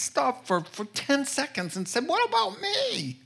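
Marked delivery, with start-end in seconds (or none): 2.1-3.2 high whiny mock complaint, raised well up; mock self-pity. Preacher imagining Jesus asking for himself, whiny mock complaint. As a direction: pretend to whine like you're owed something.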